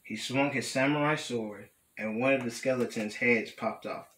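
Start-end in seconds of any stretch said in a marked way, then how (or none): none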